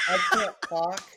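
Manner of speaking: high, loud retold giggling fit; helpless laughing. Son calls his mom mid laughing fit, re-enacted helpless laughing. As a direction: imitate fighting laughter on the phone. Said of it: "I just start laughing again".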